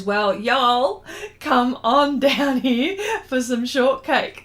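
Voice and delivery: loud, slow Southern drawl; hammed-up Southern US invitation; Southern US accent. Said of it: "Did I say that right".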